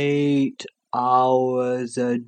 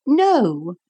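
put-on firm little girl; 9 semitones higher than usual. Lucy refuses firmly.

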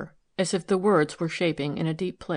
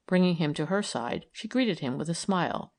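ordinary delivery

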